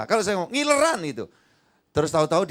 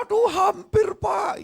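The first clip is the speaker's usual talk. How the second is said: desperate groan; slower than usual. Retold or imitated. Churchgoer retells desperate need to pee.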